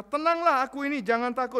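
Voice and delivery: high, loud, commanding reassurance; Jesus calming. Acted. Jesus calls to terrified disciples across water, ringing. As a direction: call out to calm the frightened disciples. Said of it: "Yesus berkata".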